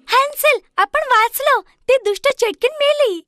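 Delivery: put-on high, excited girl's voice; joyful Gretel. Gretel freeing her brother after the witch dies, high and joyful. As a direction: tell him the good news gladly.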